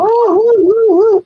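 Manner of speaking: high, loud whooping; playful high woo-hoo chant; mock cheer. Cheering along to have fun.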